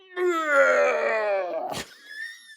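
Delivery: loud mock retch; retching groan. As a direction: gag loudly like vomiting. [name] retching sound.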